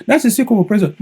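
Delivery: high put-on American voice; mock American accent. Vlogger mocking a politician's speech, high mock American accent. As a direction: ham up the foreign accent. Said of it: "you hear accent".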